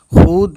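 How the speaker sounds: "खुद" drawn out as khood; long vowel, not to use. Shown as a demonstration. Teacher warning against the misreading, long vowel. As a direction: lengthen the u in खुद to show the misreading. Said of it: "खुद ना पढ़ के".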